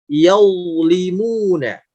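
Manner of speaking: "Yaulimuna" drawn out slowly, first syllable thickened to "yau"; slow, heavy "yau" opening "Yaulimuna". Shown as a demonstration. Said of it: "kita akan coba contohkan". ("Yaulimuna" pelan, "yau" tebal dengan pangkal lidah naik)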